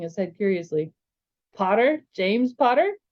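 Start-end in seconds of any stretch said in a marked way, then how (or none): 1.5-3.0 "Potter" lifted at the end as a question; questioning repeat. Acted. Zane checks the name he just heard, questioning. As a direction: repeat "James Potter" with rising, curious disbelief. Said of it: "he repeated".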